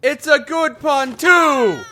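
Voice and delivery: put-on high, shouted Brooklyn accent. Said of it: "I don't know why I have a Brooklyn accent".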